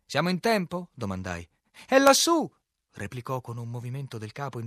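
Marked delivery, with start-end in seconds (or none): 0.0-0.9 raised, loud query; called-out question. A narrator's reading. Captain to harlequin ashore, loud called question. 1.9-2.5 very high, loud cry; shouted reply. A narrator's reading. Harlequin pointing captain to the hill, high shout.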